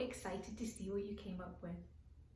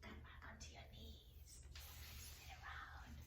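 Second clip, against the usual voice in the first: much softer whisper; 16 dB quieter than usual. Real, not acted. Teacher whispering yoga cues to the children. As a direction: hush the yoga cue.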